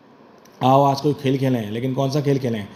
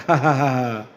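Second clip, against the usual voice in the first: laughing; slower than usual. Acted. Laughs after confessing scolding.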